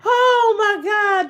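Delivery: drawn-out laughing oh my God; slow amused exclamation. Real, not acted. Reacting to viewers' tampon joke, slow amused gasp. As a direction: stretch it out mid-laugh.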